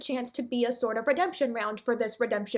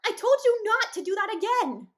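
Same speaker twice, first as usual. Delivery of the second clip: higher, louder scolding; exasperated yell; 9 semitones higher, 4 dB louder than usual. Real, not acted. Reviewer yelling at the show, exasperated. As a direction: yell at the screen.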